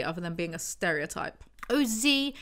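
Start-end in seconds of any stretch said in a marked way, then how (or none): 1.7-2.3 higher-pitched, hammed-up exclamation; light, put-on cry in a higher voice; mock cry. Jack cries out to Z, mock cry. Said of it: "Jack cried".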